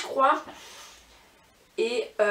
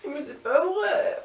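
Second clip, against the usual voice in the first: laughing disbelief; 6 semitones higher, slower than usual. Real, not acted. Rire puis incrédulité.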